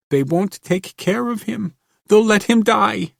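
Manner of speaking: high, loud, panicked woman; panicked scream. A narrator's reading. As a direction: scream it in terror. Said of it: "Don't scream like that".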